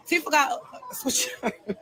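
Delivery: laughing through the line. Real, not acted. Repeating her warning about Tifa to the hosts, laughing. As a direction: chuckle the phrase out as you say it.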